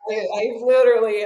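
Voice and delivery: ordinary delivery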